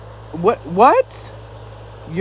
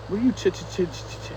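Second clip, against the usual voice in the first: stuttering; 9 dB quieter, faster than usual. Real, not acted. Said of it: "That's what you sound like".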